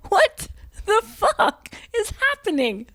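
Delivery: high, slow, mock-anguished wail; bewildered. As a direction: drag it out as if at your wits' end. Acting out her daily bewilderment.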